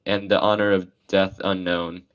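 normal talking voice

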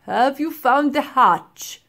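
put-on slow, loud commanding old man; demanding professor. A narrator's reading. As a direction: demand it sternly. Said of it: "demanded the professor".

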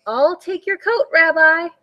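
lightly voiced old Baba, sweet and welcoming; a hint of a delighted old granny at the door; old woman's voice. A narrator's reading. Old woman greeting her guest at the door, sweetly.

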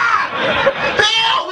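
very high shriek; mock scream. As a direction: shriek as if hit by a taser. Imitating tased man screaming.

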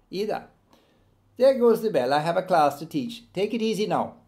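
Errol's voice. Acted. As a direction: call it out cheerfully over the bell. Errol leaving at the bell.

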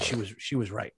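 usual speaking voice

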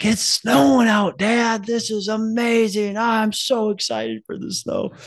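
put-on high, slow, thrilled kid's voice; excited little son. Son telling his dad about the snow, high excited kid voice. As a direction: play the little boy amazed by snow. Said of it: "He's like".

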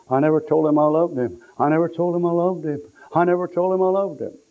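high, keening imitation of grief; acting out a grieving sister's cry; retold crying lament. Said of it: "and crying. Her older brother saying".

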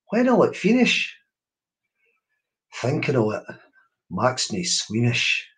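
slow, wondering poem reading; poem recital. Acted. Reading the Space poem to listeners, hushed wonder. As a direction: let each line hang in the air.

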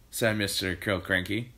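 usual speaking voice

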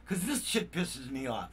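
usual speaking voice

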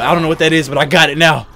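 usual speaking voice

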